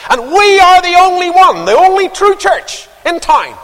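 high, loud mock churchman; pompous caller. Church caller phoning the preacher, pompous. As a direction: mimic the pushy caller. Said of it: "And he says".

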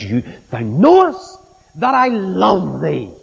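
slow, swelling stress on "knowest" and "love"; fervent confession. Retold or imitated. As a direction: draw out "knowest" and "love" with conviction. Peter's fervent confession acted out.

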